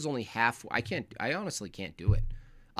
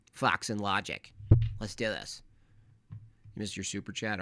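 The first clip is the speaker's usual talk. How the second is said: ordinary delivery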